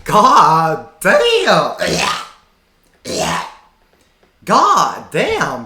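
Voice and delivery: loud, stunned "God damn"; reacting to an abusive clip, loud disbelief; shocked exclamation. Real, not acted. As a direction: blurt it out in disbelief.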